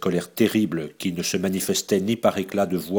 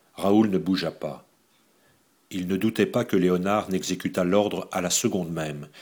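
ordinary delivery

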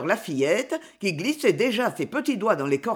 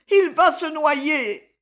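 higher, louder, slow panicked cry; frightened cry; 8 semitones higher, 5 dB louder, slower than usual. Acted. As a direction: wail it in fear. Frightened girl fears drowning. (cri apeuré, plus aigu, lent)

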